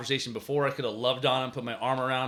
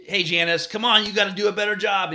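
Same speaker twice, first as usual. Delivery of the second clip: higher, louder nagging coach; getting on kids; 6 semitones higher, 6 dB louder than usual. Shown as a demonstration. Coach getting on a kid not doing a good job, scolding. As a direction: demonstrate a coach getting on a player. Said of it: "getting on the kids who aren't doing a good job".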